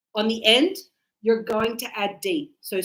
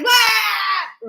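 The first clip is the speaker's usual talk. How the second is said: high, loud "Wah"; demonstrated crying wail; 9 semitones higher, 6 dB louder than usual. Shown as a demonstration. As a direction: bawl like a crying child, for show. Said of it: "because cry is".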